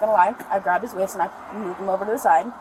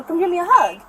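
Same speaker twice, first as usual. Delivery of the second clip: put-on high, louder coaxing of a little kid; mom calling child; 8 semitones higher, 5 dB louder than usual. Mother re-enacting asking her son for a hug, high calling. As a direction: call a child over, pitched up.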